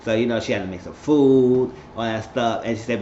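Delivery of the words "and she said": ordinary delivery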